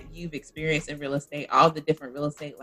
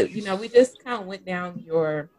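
usual speaking voice

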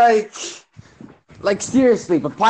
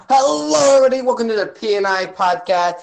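podcast host greeting; 4 semitones higher than usual. Acted.

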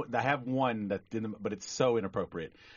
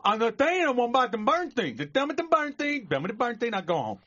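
loud, high, mangled rant; frustrated garbled customer; 12 semitones higher, 5 dB louder than usual. Acted. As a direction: yell the mushed words in frustration.

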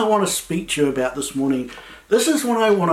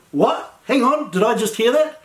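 higher-pitched, halting double take; mildly acted disbelief; 6 semitones higher than usual. Mary answers the angel's news, lightly acted bewilderment. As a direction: imitate someone not quite believing their ears. Acts Mary's stunned reaction to angel.

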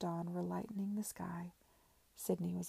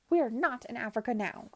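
louder, contemptuous jab; Madam snaps at her prisoner, louder; scornful outburst; 4 semitones higher, 8 dB louder than usual. A narrator's reading. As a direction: sneer it down at her. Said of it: "her composure gone".

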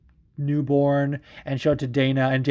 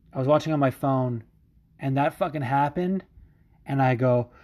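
ordinary talk

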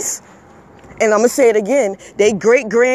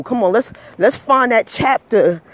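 ordinary talk

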